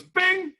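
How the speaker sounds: very high-pitched "bing"; ping it like a tiny bell; magic chime. Acted. God granting a wish, high magic chime.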